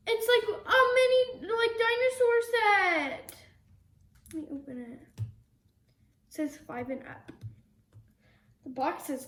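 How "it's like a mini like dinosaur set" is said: gasps, then gushes high and loud; thrilled discovery of a mini dinosaur set; excited gasp. Real, not acted. Gasp at new toy.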